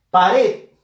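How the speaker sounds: loud "Pared", stressed on "red"; stress on last syllable. Shown as a demonstration. Teacher giving an example word, stress on "red". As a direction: call out "Pared" with force on "red". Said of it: "Fuerza de voz en la sílaba red".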